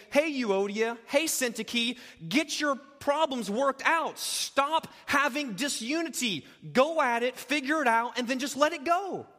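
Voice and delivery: a blunt rebuke, loud; mock scolding. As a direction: snap at her to shape up. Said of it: "What he doesn't do is just merely say".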